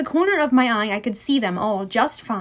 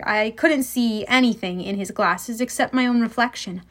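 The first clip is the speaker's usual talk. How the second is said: plain reading voice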